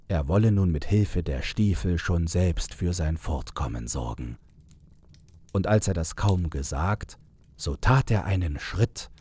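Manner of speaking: usual speaking voice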